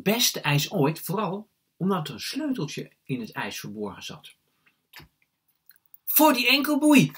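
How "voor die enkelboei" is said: put-on high, loud boy's cry; excited shout. A narrator's reading. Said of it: "riep Jesper".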